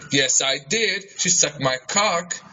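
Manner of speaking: normal talking voice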